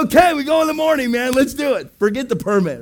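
high, loud and fast rally cry; fired-up rallying. Retold or imitated. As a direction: pump the team up, rushing. Pastor fires up the team.

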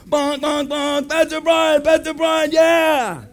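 high, honking, cheering calls; cheering drivers. Retold or imitated. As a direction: honk and hail him from the road. Drivers honk and cheer him.

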